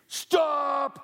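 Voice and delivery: high, loud barked stop; guard's halt. Acted. Big ant stops the boy, loud command. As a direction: freeze him in his tracks.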